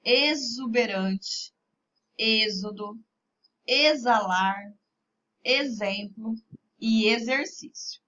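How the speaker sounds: "Exuberante, êxodo, exalar, exemplo e exercício" with X as a voiced Z. Shown as a demonstration. Teacher shows pupils the sound, "Exuberante, êxodo, exalar, exemplo e exercício" with X as Z. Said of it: "com som de Z".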